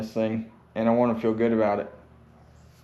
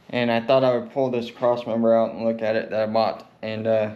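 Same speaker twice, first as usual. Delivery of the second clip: ordinary delivery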